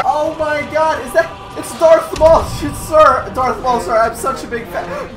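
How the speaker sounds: high, excited gushing; breathless high fanboy voice; starstruck fan. Pretend. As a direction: gush like a fan meeting his idol. Starstruck fan meeting Darth Maul.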